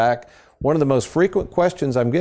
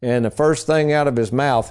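ordinary talk, nothing marked

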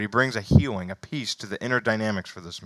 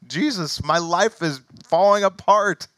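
mock panic; 9 semitones higher, 6 dB louder than usual. Imitating panicked believer's prayer.

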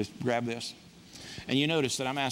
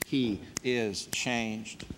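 slow solemn refrain; 4 semitones lower, much slower than usual. Real, not acted. Pastor closing each changed-life example, slow hushed refrain. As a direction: let the words sink in slowly.

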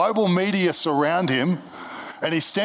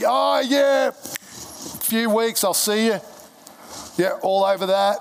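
high, casual mock "see you later"; breezy brush-off; 6 semitones higher, slower than usual. Preacher mocking half-hearted believers, airy brush-off. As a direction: toss it off like a half-hearted goodbye.

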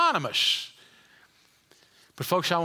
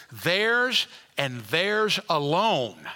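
"theirs and theirs alone" drawn out very slowly, each word weighted; emphatic; 4 semitones lower, much slower than usual. Shown as a demonstration. Preacher stresses scripture wording to congregation, emphatic and slow. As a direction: lean hard on "theirs" both times. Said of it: "actually it's emphatic".

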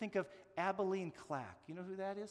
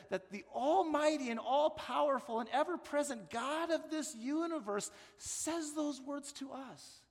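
higher, louder, swelling with awe; building preaching; 7 semitones higher, 5 dB louder than usual. Real, not acted. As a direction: preach it with rising wonder. Rising preaching passage.

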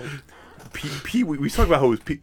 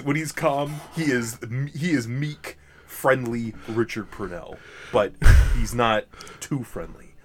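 normal talking voice

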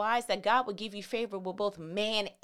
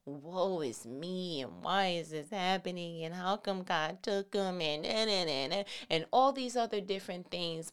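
mock lament; 6 semitones lower, 6 dB quieter than usual. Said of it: "this emotional rut of, like".

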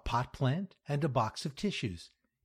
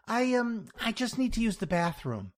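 higher-pitched, faltering woman client; hesitate, then ask in a raised voice; hesitant Louise; 11 semitones higher, 3 dB louder than usual. Acted. Louise asking her therapist for the bathroom, hesitant.

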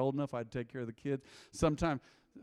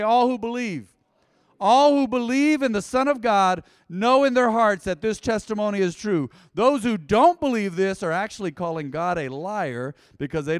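loud, raised preacher's voice; emphatic scripture reading; 4 semitones higher, 7 dB louder than usual. Acted. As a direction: proclaim the verses with full conviction. Reads scripture to congregation.

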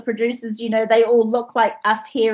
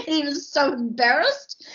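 ordinary talk

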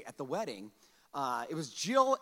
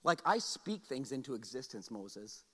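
the Lord; 6 semitones lower, 6 dB quieter than usual. Acted. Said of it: "Then the Lord asked Moses".

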